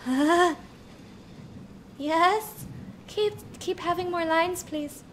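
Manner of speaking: high-pitched, breathless-with-interest plea; high, eager acted swoon; eager fangirl reaction. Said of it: "I'm like".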